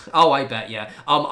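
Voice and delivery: ordinary delivery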